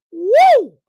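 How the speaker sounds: high, loud hype whoop; excited woo. Real, not acted. Host celebrating Steve Atwater, loud woo. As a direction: whoop for the hitter. Hyping a hard hitter.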